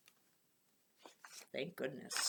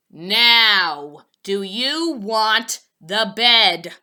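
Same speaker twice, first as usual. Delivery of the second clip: slow, louder pointed question; impatient sales lady; 8 dB louder, much slower than usual. A narrator's reading. Sales lady pressing Poppleton again, slow and loud. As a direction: lean on it with strained patience.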